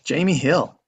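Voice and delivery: ordinary talk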